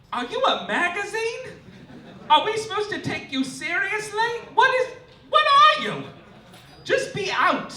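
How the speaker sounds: high, incredulous comic rant; exasperated disbelief. Acted. Comic host ranting at The Advocate, high and incredulous. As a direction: pitch way up, baffled and demanding answers.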